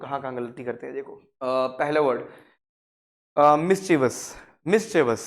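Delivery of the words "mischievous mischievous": mischievous as three syllables, MIS-chuh-vus; correct pronunciation. Shown as a demonstration. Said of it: "But इसका correct".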